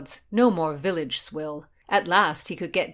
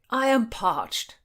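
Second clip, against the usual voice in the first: whined; 6 semitones higher, 6 dB louder, slower than usual. A narrator's reading. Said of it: "whined William".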